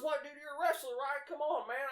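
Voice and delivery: high, loud, pushy goading; imitated goading boss. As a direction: egg him on as the rowdy boss. Owner goading him to wrestle.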